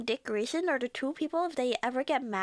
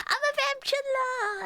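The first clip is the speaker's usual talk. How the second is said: much higher, proud cry; excited proclamation; 12 semitones higher than usual. Pretend. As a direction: announce the name with glee. Proclaiming new nickname.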